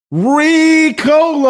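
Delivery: long, high, drawn-out yodel of Ricola; mock Ricola call. Host jokes about his sinus cold with a stretched high Ricola call. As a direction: sing out Ricola slowly like the cough drop ad.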